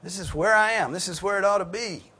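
high, loud, eager affirmation; motivated enthusiasm. Acted. Believer motivated by reading the psalm, excited agreement. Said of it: "it motivates us to read".

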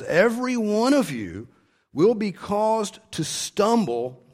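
high, loud and very slow on every one of you; emphatic warning. Shown as a demonstration. Preacher voices Jesus warning the disciples, emphatic and slow. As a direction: lean hard on every one of you, drawn out. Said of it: "is emphatic".

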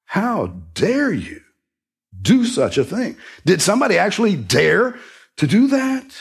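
"dare" hit high, pitch climbing; stress on dare. Shown as a demonstration. Preacher voicing Paul's shock at the church, dare stressed. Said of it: "emphasize that word dare".

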